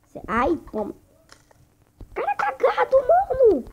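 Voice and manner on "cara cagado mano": high, loud yell at the screen; shouting. Real, not acted. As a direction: yell it out in outrage. Shouting at lucky opponent.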